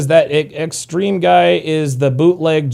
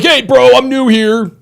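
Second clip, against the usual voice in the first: mocking newcomer; 10 semitones higher, 6 dB louder, slower than usual. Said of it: "they're like".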